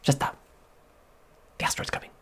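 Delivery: whispered, secretive order; soft hushed voice of officials; hushed quote. Retold or imitated. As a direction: hiss it like a secret warning. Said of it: "They would be like".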